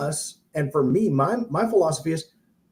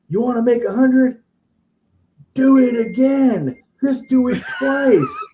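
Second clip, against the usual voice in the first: mock shout; 9 semitones higher, 6 dB louder than usual. Guru firing up the crowd, loud mock preacher shout. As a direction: whip up the crowd, hammed-up. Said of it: "and he goes".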